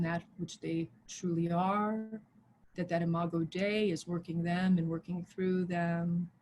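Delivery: hushed, level prayer with long pauses; spoken prayer. Real, not acted. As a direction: pray quietly over them, unhurried. Leads a prayer.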